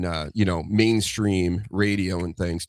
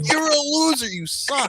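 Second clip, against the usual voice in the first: much higher, put-on taunting fan voice; mock jeering fan. Old Boston fans trashing their team, high mock jeer.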